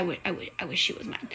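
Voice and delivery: quick under-the-breath whisper; teasing whispered aside. Real, not acted. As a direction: slip it in as a private whisper. Whispered aside about Sam.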